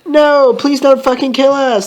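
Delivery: high, drawn-out mock plea; pleading. Victims begging the shooter for their lives, pleading. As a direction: beg in a high, stretched voice. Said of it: "they pleaded".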